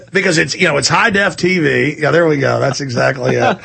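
ordinary talk

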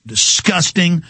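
usual speaking voice

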